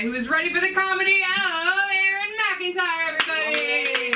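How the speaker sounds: high, sustained singsong introduction; announcer. Real, not acted. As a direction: build the crowd with a stretched-out call.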